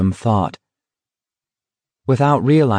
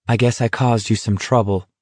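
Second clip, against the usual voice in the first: ordinary delivery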